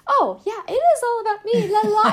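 high, loud careless sing-song; mock her younger self-centred self; self-absorbed kid. Mocking her younger self for thinking only of herself, high and sing-song. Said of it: "it's just like".

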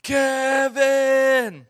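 loud, high, long-stretched holler; dad shouting. Retold or imitated. Dad searching the woods for his child, full shout. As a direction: call out as loud as you can. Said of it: "shouting in the woods, like, as loud as he could".

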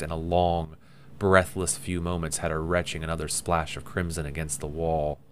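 plain reading voice

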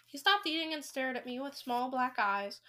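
ordinary delivery, nothing marked

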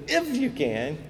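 high, incredulous echo; frustrated. Retold or imitated. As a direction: throw the words back in exasperated disbelief. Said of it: "you just hear it in Jesus' voice".

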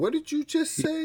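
high incredulous repeat; surprised disbelief. Real, not acted. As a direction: ask it again in disbelief. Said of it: "repeated question".

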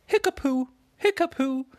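magic lion casts a spell on the puddle, squeaky and loud; silly magic word. Acted. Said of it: "Liggy Lion said".

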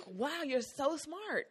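slow, high, patronizing fake praise; mock amazement. Imitating coworkers who treat you like a circus animal, patronizing. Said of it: "You get treated like".

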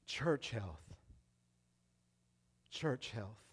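"Church health" low and soft, slow with long pauses; quiet emphasis. Shown as a demonstration. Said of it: "repeated phrase fading down".

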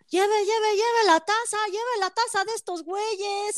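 high, drawn-out hawker call; street vendor shout. Retold or imitated. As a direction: yell souvenirs outside a concert. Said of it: "el güey gritando afuera de".

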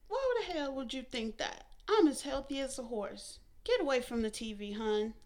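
mother chiding her smart daughter; scolding mother. A narrator's reading. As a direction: lecture the daughter firmly. Fern brushing her off.

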